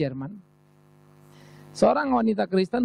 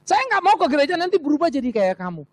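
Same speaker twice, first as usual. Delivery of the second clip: husband's retort; 8 semitones higher than usual. Retold or imitated. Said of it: "Dia bilang".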